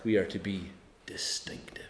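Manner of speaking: soft, hushed whisper to the congregation. Acted. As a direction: let it fall to a breath. Preacher drops to a whisper.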